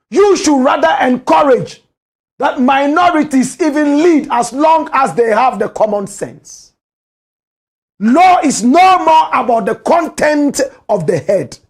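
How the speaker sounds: loud, high indignant lecture; angry shout. Real, not acted. Ranting commentator shouting at the woman's supporters. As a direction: hammer the point home, outraged. Angry rant about minorities leading.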